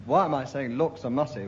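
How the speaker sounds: ordinary talk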